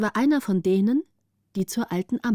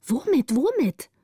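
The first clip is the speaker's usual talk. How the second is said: high, urgent repeated question; anxious interruption; 6 semitones higher than usual. A narrator's reading. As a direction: cut in, desperate to know. Anxious children interrupt the lookout. (aufgeregt drängendes Nachfragen)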